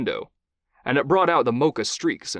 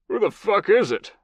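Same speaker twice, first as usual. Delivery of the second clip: put-on higher, louder Southern US accent, woken and annoyed; thick southern accent; 10 semitones higher, 4 dB louder than usual. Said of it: "came the thick, southern accent".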